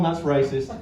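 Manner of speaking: ordinary delivery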